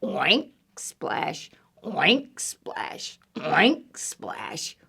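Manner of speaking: short rising oinks with soft splashes; piggy oink and splash. Acted. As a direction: oink like a pig, hush each splash. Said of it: "And Pig says".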